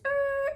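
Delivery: high-pitched mimicked tire squeal; vocal sound effect of a speeding car; imitated car screech. Imitates car flying off highway.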